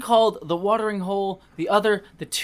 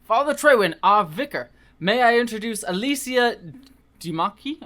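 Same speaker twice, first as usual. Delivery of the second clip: put-on British accent; 6 semitones higher, 3 dB louder than usual. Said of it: "all the British accents here".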